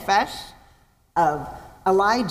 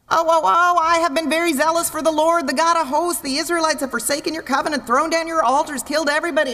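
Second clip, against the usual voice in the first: whining Elijah; 4 semitones higher than usual. Retold or imitated. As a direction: whine like a self-pitying prophet. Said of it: "Elijah starts to whine".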